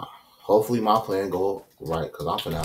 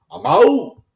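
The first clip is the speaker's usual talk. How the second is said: high, loud parting shout; loud sign-off; 14 dB louder than usual. Real, not acted. Vlogger shouts goodbye to viewers at the end. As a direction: yell the goodbye.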